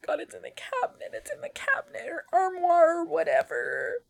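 high, hushed scared whisper; frightened whisper. Real, not acted. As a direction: whisper as if it could hear you. Whispering about lurking creature.

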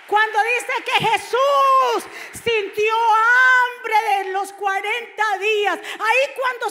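grito lento, mucho más agudo y fuerte; predicación a gritos. Real, not acted. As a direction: clama como predicador en pleno fervor. Predicador clama a la congregación. (grito de predicador, lento y agudo)

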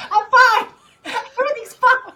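very high, loud, laughing insistence; laughing off tears. Real, not acted. Griever assuring her co-host, laughing off tears. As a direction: squeal it through an emotional laugh.

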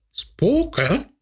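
high, startled one-word question; trembling fright. A narrator's reading. Aunt reacting to talk of ghosts, shuddering with fright. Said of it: "sidderde Jans tante".

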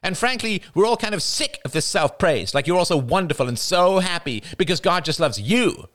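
high, bitter, accusing outburst; venomous passion. A narrator's reading. Jada accusing the praying family, high venomous voice. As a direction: spit out the grievances. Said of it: "Her voice trembled with venomous passion".

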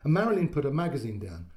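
ordinary delivery

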